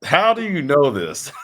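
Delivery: high, drawn-out real astonishment; amazed disbelief. Real, not acted. Guest hears host name his old tag team, astonished. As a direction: ask in delighted disbelief, breaking toward laughter.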